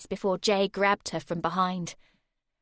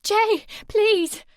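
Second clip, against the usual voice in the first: crying plea; 12 semitones higher, 9 dB louder than usual. A narrator's reading. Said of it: "she started to cry".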